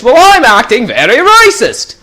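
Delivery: high, loud put-on Scottish-Aussie accent; mock Scottish accent. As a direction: keep the silly Scottish voice going. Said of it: "increasingly Australian".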